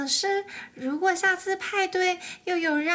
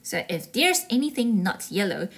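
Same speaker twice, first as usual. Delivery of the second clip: story narration; 4 semitones lower than usual. A narrator's reading.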